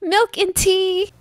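high, slow luring call. Pretend. Player luring a friend with British drinks, slow high call. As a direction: stretch it out high, calling. Another food call as lure.

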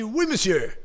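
"Oui, monsieur" shouted out loud and high; loud confident answer. Shown as a demonstration. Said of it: "on parle fort". (« Oui, monsieur » crié fort avec assurance)

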